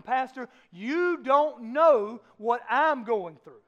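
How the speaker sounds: slow, loud, pleading "Pastor"; a congregant complaining to the pastor, loud and slow; hurting congregant. Retold or imitated. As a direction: voice a struggling churchgoer crying out. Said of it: "some of you will say this morning".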